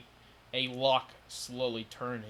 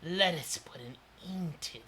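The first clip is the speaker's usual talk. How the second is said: whispered menace; 5 semitones higher than usual. Acted. Monster's closing command.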